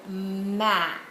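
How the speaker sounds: "map" stretched out sound by sound; sounded-out word. Shown as a demonstration. Teacher gives student the word for the pennies, sounded out.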